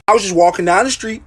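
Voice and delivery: ordinary talk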